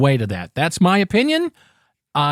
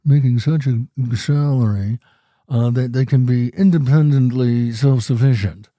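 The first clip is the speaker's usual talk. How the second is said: a put-on snooty voice; mock a smug career lover; resting bitch face voice; 3 semitones lower than usual. Mocking workaholic listeners, in a snooty put-on voice. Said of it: "that's my resting bitch face voice".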